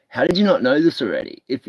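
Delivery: ordinary talk, nothing marked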